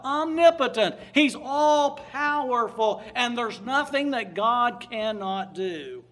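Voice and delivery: loud, emphatic pulpit proclamation; preaching at full force. Acted. Preacher extolling God's power to the congregation, booming. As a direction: proclaim it to the back row.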